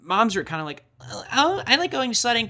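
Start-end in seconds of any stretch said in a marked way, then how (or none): 1.3-2.4 high, light imitation of a mom; mock mom voice. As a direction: play a breezy mom, pitched up. Mocking how moms talk.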